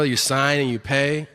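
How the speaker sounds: ordinary talk, nothing marked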